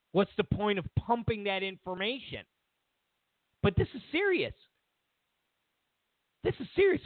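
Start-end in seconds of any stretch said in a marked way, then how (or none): 3.6-4.5 mock alarm. Mock-outraged rant about Russians. 6.4-7.0 mock alarm. Repeated with more alarm.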